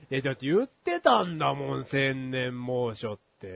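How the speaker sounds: normal talking voice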